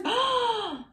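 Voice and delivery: loud, high gasp; big high-pitched gasp; startled gasp. Real, not acted. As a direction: gasp sharply in shock. Startled after using her fingers.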